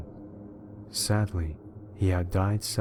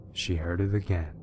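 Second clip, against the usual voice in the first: ordinary delivery, nothing marked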